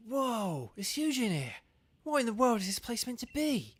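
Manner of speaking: high, breathless wonder; awed surprise. Acted. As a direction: gasp at the huge room. Reads rival's awed line.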